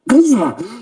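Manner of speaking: high acted gasp for air; mock relieved gasp; gasp after held breath. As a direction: let a held breath burst out. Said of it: "He's, like, holding his breath".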